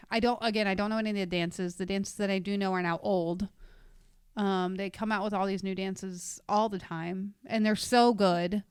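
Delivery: normal talking voice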